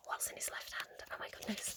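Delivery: hushed whisper full of suspense. Real, not acted. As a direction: whisper it with playful anticipation. Teasing suspense over card image.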